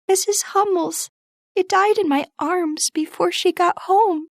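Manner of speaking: put-on high, upset young girl, a touch tearful; crying out in grief. A narrator's reading. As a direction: let Beth's grief rise in her voice. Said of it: "cried Beth".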